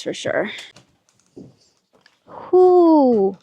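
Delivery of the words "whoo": calling out. Real, not acted. Calling out in dark woods.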